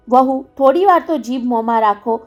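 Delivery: ordinary delivery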